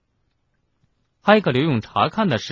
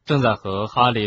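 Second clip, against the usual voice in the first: usual speaking voice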